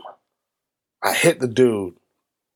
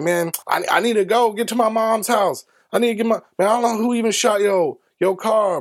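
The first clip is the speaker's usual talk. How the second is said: pleading excuses; 8 semitones higher than usual. Retold or imitated. Caught gunman making excuses.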